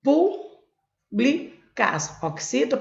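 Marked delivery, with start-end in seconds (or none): 0.0-0.5 Públicas split into PÚ, BLI, CAS with pauses; syllable by syllable. Shown as a demonstration. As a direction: break Públicas apart, rising on PÚ. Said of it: "Eu vou separar". (Públicas separada em sílabas, com pausas)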